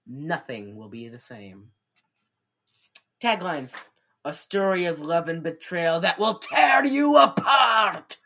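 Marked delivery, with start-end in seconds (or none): 4.2-8.2 loud, hammed-up movie trailer; dramatic trailer voice. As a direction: belt the tagline like a trailer. Reading tagline dramatically.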